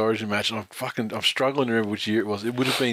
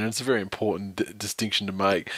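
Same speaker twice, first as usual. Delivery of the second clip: normal talking voice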